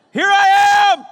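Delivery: loud high shout of Here I am. Shown as a demonstration. Pastor illustrating Jesus yelling behind a door. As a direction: yell it through the door. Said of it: "I wanna illustrate it".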